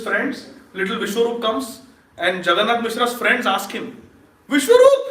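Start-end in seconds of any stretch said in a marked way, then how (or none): 4.5-5.0 high, louder call of a name; calling the boy. Acted. Father's friends call to little Vishwarup, high and bright. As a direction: call out to get the child's attention.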